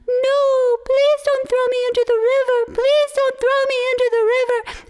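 high, loud mock-pleading turtle cry; high, loud pretend plea to not be thrown. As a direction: beg loudly, faking terror. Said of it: "was crying out".

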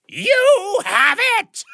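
sudden high, loud slow shout; jump scare shout. Acted. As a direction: leap out and yell it. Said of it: "the jump line in the story".

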